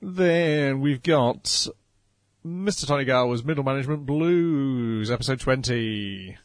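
title announcement. Shown as a demonstration. Co-host introducing the next episode, title announcement. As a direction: stretch out the title like a show announcer.

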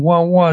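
high, loud puppy crying; puppy whimper. Retold or imitated. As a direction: whine like a little puppy. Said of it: "little puppy dog making little, like, going".

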